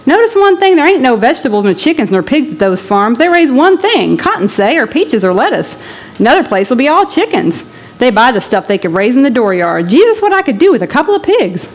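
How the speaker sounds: a hungry farmer getting carried away; excited Okie man. Acted. Okie dreaming of land.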